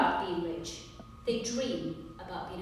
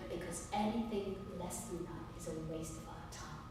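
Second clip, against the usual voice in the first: quiet parting jab; 4 semitones lower, 14 dB quieter than usual. Acted. Lawyer to opposing side after rejecting their offer, quiet parting jab. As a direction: drop to a much softer voice, understated and pointed.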